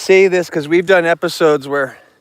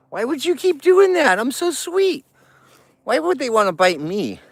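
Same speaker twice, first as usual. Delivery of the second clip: mock-hurt pet talk; 8 semitones higher than usual. Real, not acted. Man to a biting bear, high mock-hurt baby talk. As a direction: plead sweetly in a silly high voice.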